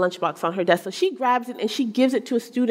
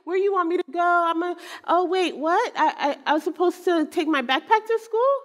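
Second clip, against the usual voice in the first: put-on higher-pitched, scatterbrained kid voice, questions rising; absent-minded son; 7 semitones higher than usual. Mother playfully imitating her forgetful son, absent-minded and high. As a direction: imitate a forgetful boy catching himself mid-thought, pitched up. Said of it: "a little absent-minded. He kinda just lives the world like".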